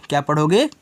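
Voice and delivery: usual speaking voice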